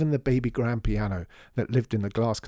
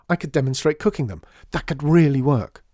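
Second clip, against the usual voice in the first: louder, higher, enthusiastic pitch; excited; 5 semitones higher, 8 dB louder than usual. A narrator's reading. John sells his cookery idea to the others, excited. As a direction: build to a loud, emphatic finish.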